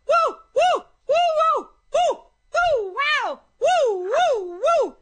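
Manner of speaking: high whooping calls; joyful high-pitched whoops; mojo whoops. Real, not acted. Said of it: "You can whoop with me".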